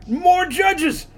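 high, loud cackling hillbilly; excited villain shout. Acted. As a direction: yell it in manic glee. Villain spots judges.

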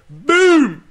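big high shouted announcement; triumphant entrance. Pretend. Imagining the tanned groom entering his party, shouting. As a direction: burst into the room like a returning star. Mock grand entrance after honeymoon.

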